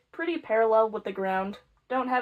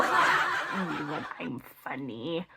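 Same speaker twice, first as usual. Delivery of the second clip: low, slow chuckle at own joke; amused laughing aside; 6 semitones lower, 5 dB quieter, much slower than usual. Real, not acted. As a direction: chuckle through it, tickled by your own pun.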